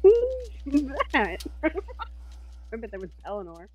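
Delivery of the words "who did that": high, drawn-out mock questioning; playful squeal. High-pitched joking question.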